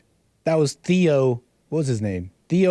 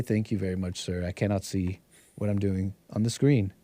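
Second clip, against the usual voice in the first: much softer, lower aside to the crew; quiet aside; 9 semitones lower, 8 dB quieter than usual. Real, not acted. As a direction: murmur it off-mic to Bill. Aside to crew member Bill.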